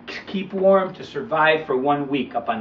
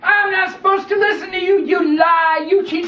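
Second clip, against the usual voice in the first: put-on high, loud, suspicious boy; young boy refusing the snake, loud and suspicious; boy's voice; 14 semitones higher, 4 dB louder than usual. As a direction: accuse him like a wary kid.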